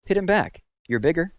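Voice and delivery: high, loud egging on; a counselor egging a boy into a fight, loud; goading shout. A narrator's reading. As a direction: urge him to fight back, loud and high. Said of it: "he said".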